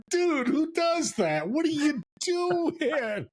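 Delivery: high, slow, stretched-out surfer voice; surfer guy. Retold or imitated. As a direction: play the baffled laid-back California surfer. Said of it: "he's a surfer guy".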